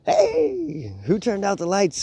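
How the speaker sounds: very high surprised cry; startled hero. Acted. Spider-Man caught in the smoke, high startled call. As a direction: yelp it as the lights go out.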